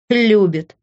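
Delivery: mildly scoffing echo; scornful snort. A narrator's reading. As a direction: throw the word back with disdain. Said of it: "фыркнула я". (презрительно фыркнув)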